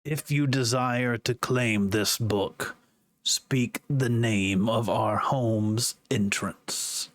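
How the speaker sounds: slow eerie demonic voice fading to a whisper; haunting voice. Acted. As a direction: intone it like a demon book in your head. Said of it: "almost eerie demonic voice".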